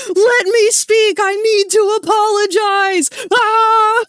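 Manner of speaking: high, loud, slow panicked pleading; mock panic. Stuck in chat, panicking to apologize, loud and high. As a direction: plead frantically to be let in. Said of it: "panicking behind the keyboard like".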